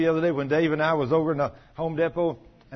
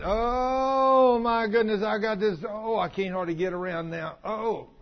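long-drawn, high "oh" sinking into a weary moan; imitating a self-pitying groaner; mock groaning complaint; 5 semitones higher, slower than usual. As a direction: ham up the morning aches and pains. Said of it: "Do you hear people talk like that".

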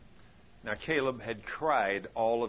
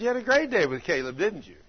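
triumphant; 5 semitones higher, 6 dB louder than usual. Retold or imitated. Triumphant retold reply.

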